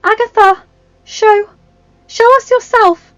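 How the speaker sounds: high, loud, urgent plea; earnest cry. A narrator's reading. Belle begs the mirror to reveal Agatha, crying out. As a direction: call out high and loud, pleading. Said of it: "Belle cried, staring at the object in earnest".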